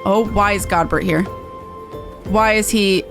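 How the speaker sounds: ordinary talk, nothing marked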